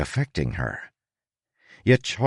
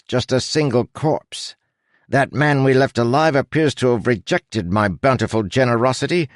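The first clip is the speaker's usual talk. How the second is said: high, stately aristocratic captain; pompous captain delivering news; 7 semitones higher, 3 dB louder than usual. A narrator's reading. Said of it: "said in his captain voice".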